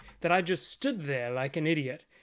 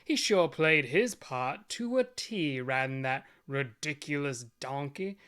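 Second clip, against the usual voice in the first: normal talking voice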